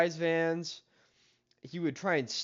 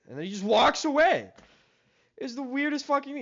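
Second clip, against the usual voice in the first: ordinary talk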